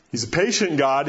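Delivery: ordinary delivery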